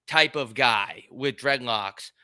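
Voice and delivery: ordinary delivery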